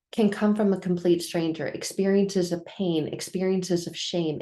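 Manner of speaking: normal talking voice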